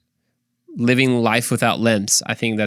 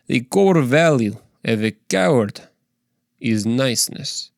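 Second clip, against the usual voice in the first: a rough Russian accent. Shown as a demonstration. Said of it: "let me say that in Russian".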